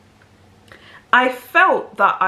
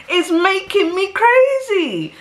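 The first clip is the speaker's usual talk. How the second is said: higher, slower, pushing it out in disbelief; half-laughing, louder "crazy"; incredulous repeat; 12 semitones higher, 4 dB louder, slower than usual. Real, not acted. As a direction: say it again like you still can't believe it.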